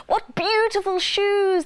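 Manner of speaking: high, loud, drawn-out admiration; excited cry. A narrator's reading. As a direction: cry out slowly in high delight. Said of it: "they cried".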